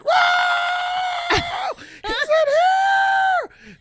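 high yelling of a whole cell; excited shout. Retold or imitated. Cellmates yelling out that he's found, loud high shout.

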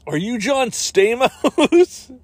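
high, slow, stretched-out question; hammed-up movie urgency. Time traveler finding John Stamos in fake trailer, hammed-up urgency. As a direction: draw the name out in mock drama.

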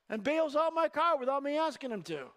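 ordinary talk, nothing marked

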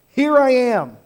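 bright, loud, eager "Here I am"; eager reply. Pretend. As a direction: answer like a volunteer jumping up.

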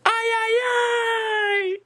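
very high squealed signal; mock scream. Man signalling his friend across the cinema, high mock scream. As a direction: cry it out high and long, hammed-up. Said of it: "Eu grito".

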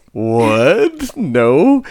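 loud, incredulous retold denial; baffled denial. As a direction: act out total bafflement at a mistake. Retells own baffled reply to customer.

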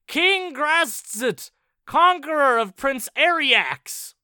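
lightly voiced demon throng cheering; mock demon chorus. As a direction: hail the conqueror like a throng. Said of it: "voices that chirped, bellowed, grated".

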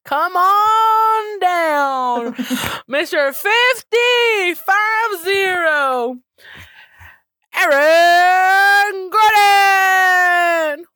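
drawn-out, loud arena announcer, pitched high; hype announcer. Pretend. Introducing a basketball player to the crowd, hammed-up announcer. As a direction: stretch every word out like a game announcer. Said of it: "I should be an announcer".

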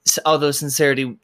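ordinary delivery

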